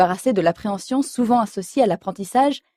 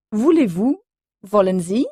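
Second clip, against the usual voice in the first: normal talking voice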